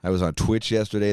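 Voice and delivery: normal talking voice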